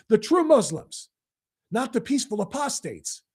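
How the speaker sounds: normal talking voice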